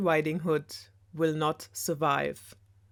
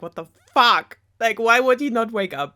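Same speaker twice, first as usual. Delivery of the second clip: incredulous; 5 semitones higher, 7 dB louder than usual. Real, not acted. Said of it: "I'm just like".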